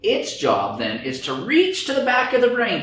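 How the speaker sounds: usual speaking voice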